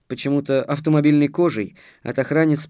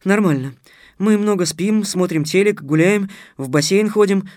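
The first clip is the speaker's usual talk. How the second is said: put-on high, eager girlish chatter; raised, lively prattle; 7 semitones higher, 5 dB louder than usual. A narrator's reading. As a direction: rattle off the list excitedly. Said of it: "затараторила Дарья".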